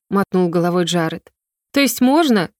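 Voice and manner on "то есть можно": higher-pitched, sly little question. A narrator's reading. Girl pressing the flustered prince for permission, slyly. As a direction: ask it slyly, with a smile. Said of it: "лукаво улыбнулась я".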